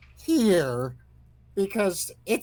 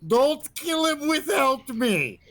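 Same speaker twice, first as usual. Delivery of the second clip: high, drawn-out wail; play-screamed plea, pitched way up; mock screaming; 9 semitones higher than usual. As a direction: cry out high and stretch the words. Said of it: "will be screaming".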